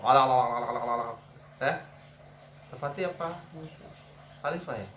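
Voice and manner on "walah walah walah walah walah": gobbling like a turkey; turkey imitation. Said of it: "dengar suara kalkun tuh sambil contohin".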